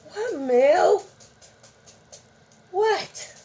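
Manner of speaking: high, rising What, Mel?; a sudden high-pitched what to the cat; surprised call to cat. Real, not acted. Owner notices the cat staring at her, surprised high call.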